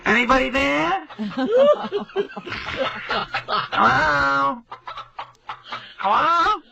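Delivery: high, drawn-out calls of hello; imitating mom calling into the machine; mother calling hello. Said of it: "she's yelling into the answering machine".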